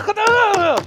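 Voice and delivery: sudden high cry of "खुदा!"; mock cry to God. Preacher reenacting his own desperate prayer, crying out. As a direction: cry out to God while pounding the floor. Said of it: "इस तरह से मैं room में हाथ मारता जोर जोर से".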